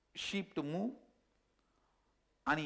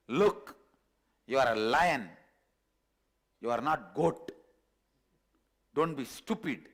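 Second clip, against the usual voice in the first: louder, firm telling-off; stern lion; 2 semitones lower, 4 dB louder than usual. Acted. Lion holding the cub by the neck, stern firm scolding. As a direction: set the foolish cub straight.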